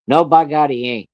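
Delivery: retold angry dad overruling someone; angry refusal. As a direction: burst out in acted defiance. Acted father angrily refuses.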